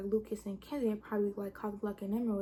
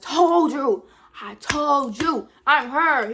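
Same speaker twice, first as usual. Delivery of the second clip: loud triumphant told-you-so, higher; gloating shout; 5 semitones higher, 13 dB louder, much slower than usual. Retold or imitated. Gloating to the boy at the movies that she was right, loud shout. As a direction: crow it at him, loud and slow. Gloating after the killer reveal.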